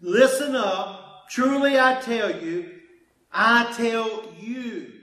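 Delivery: slow, loud, weighty pronouncement; a slow, loud declaration of divine authority; Jesus with all authority. Acted. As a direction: lay each word down with authority. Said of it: "He says".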